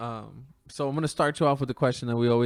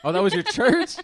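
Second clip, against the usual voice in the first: high, louder amused surprise; laughing surprise; 7 semitones higher, 9 dB louder than usual. Real, not acted. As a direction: catch the joke and laugh it out. Surprised laugh at guest's answer.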